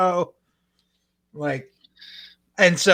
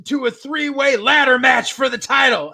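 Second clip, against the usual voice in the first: higher, slowed, shouted challenge; mock promo shout; 8 semitones higher, 4 dB louder, slower than usual. Veteran challenging Ace on the mic, hammed-up shout. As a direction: bellow the challenge like a ring announcement. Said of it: "And he's like".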